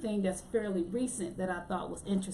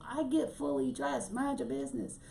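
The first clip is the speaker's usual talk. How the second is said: higher-pitched, mock-indignant pushback; defiant retort; 6 semitones higher than usual. Real, not acted. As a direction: tell them off playfully, pitch raised. Defiant retort to meme makers.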